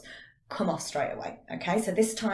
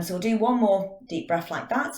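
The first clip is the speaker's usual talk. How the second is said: ordinary delivery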